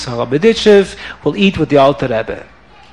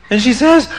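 usual speaking voice

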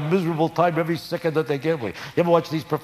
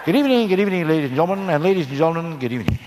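Ed Sullivan impression; 4 semitones higher, faster than usual. Ed Sullivan greeting the audience, mock stiff host. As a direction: stand stiff and intro like Ed Sullivan. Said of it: "words of Ed Sullivan".

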